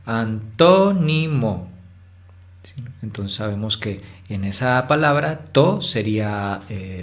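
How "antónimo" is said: antónimo drawn out, TÓ lifted high, then falling; exaggerated stressed syllable. Shown as a demonstration. Teacher showing how the voice rises, exaggerated TÓ in antónimo. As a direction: go up on tó in antónimo and come down after. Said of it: "es como si subiera".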